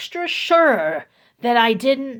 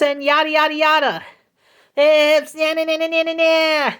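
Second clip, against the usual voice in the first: high mocking yada yada; dismissive mockery; 7 semitones higher, 5 dB louder than usual. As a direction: brush his words off in a high mock voice. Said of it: "saying, like".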